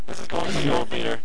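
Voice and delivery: distorted robotic mic voice; garbled robot-sounding radio voice. Real, not acted. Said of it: "The robot voice is horrible".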